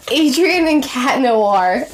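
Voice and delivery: gasp, then slow, delighted surprise; surprised gasp. Real, not acted. Vlogger reveals the names just drawn, gasping in surprise.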